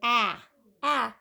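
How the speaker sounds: ordinary talk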